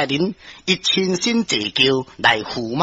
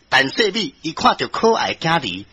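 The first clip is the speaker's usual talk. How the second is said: ordinary delivery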